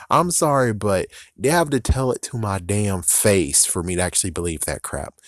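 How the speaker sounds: ordinary talk, nothing marked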